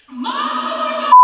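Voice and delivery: high long cry of Mom; desperate kid calling Mom. Retold or imitated. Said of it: "I was like to my mom".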